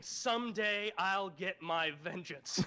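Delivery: slow, drawn-out threat; slow ominous vow. Retold or imitated. Samson leaving camp with a vow, slow and ominous. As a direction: draw out the vow like a brooding villain.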